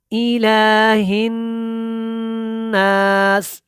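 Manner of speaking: drawn-out chanted tajweed recitation; slow Quran recitation. Shown as a demonstration. Tajweed teacher demonstrating recitation to students, slow chant. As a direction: intone each word slowly, melodically.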